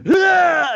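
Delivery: high loud wrestling yell; playful attack yelp; mock battle cry. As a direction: shout as you leap on someone. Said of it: "you'd be like".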